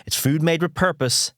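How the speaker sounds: upbeat sponsor read; bright, polished advert delivery. Acted.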